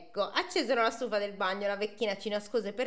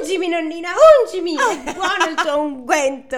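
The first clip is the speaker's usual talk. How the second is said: high, louder, giggling through the line; laughing mock moan; 8 semitones higher, 10 dB louder than usual.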